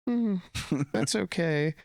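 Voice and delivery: put-on high, small disappointed girl voice; little girl's sad acceptance. Little girl told there's no candy, high disappointed voice. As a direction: let her down gently, high and small. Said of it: "she was like".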